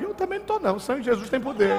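high, defiant answer; defiant retort. Retold or imitated. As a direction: stand firm against the gunman. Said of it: "Eu digo". (resposta desafiadora ao assaltante)

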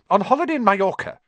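quick, high snap of a line; curt high aunt snapping; snapped. A narrator's reading. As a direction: bite it off fast and sharp. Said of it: "snapped Aunt Petunia".

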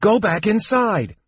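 high, urgent order to retreat; fearful cry. A narrator's reading. Palin sends kender back inside, crying fearfully. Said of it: "Palin cried fearfully".

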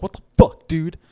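loud rasped "What the fuck"; angry. Retold or imitated. Woken by a stepped-on head.

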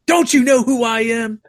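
high mock-indignant celebrity; flexing on people. Small YouTuber pulling rank on a caller, hammed-up indignation. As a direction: act outraged at not being recognised. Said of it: "just start flexing on people".

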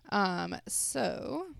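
ordinary talk, nothing marked

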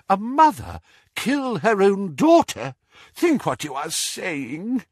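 high anguished protest, easing down; groaning dismay. A narrator's reading. Doge rebuking Muriel, anguished groan. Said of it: "groaned Doge".

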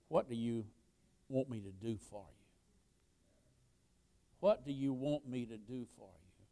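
very soft, low, intimate appeal; hushed question. Acted. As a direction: ask it gently, almost under the breath. Jesus's question put softly to congregation.

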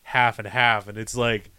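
normal talking voice

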